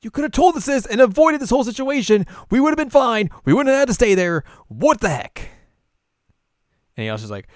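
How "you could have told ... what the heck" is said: high, loud furious scolding; mad scolding. Retold or imitated. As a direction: tell him off sharply. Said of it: "Kagome just gets real mad".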